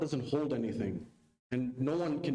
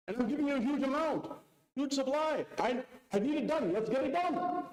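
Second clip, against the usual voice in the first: urgent buyer; 9 semitones higher than usual. Retold or imitated. Merchant pressing for grain.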